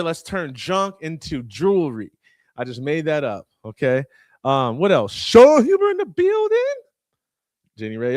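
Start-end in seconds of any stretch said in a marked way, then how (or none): 5.1-6.6 high, loud hype call of a name; big announcer shout-out. Real, not acted. Host hyping a guest to his audience, loud excited call.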